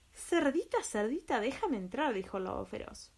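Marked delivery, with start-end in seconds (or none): 0.1-2.1 high, loud wolf calling at the door. Acted. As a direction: call out sweetly to be let in. Wolf knocks and calls.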